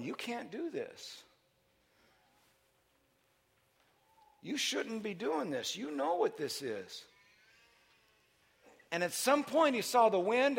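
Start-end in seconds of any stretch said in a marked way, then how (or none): 0.0-7.0 doubting inner voice. Acted. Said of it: "the old tapes were going".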